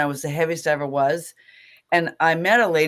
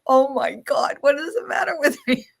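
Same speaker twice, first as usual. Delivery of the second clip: a high, half-tearful cry of disbelief; overwhelmed, voice lifting as tears come; teary outburst; 8 semitones higher than usual. Retold or imitated. Retelling the moment she saw herself and cried, mildly tearful. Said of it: "I started crying".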